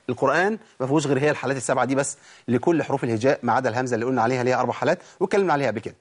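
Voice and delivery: ordinary delivery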